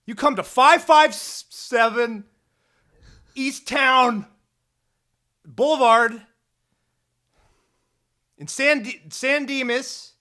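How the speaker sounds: loud, high mock wrestler promo shouting; hammed-up heel promo yell; wrestling promo. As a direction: call him out like a pro wrestler hyping a match.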